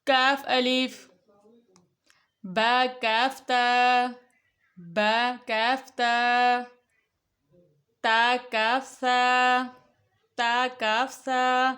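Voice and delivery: very slow, each letter held; slow recitation. Shown as a demonstration. Teacher to children reading the slate, very slow recitation. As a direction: chant the letters for the children to repeat. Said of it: "یوں بھی ہوتا ہے".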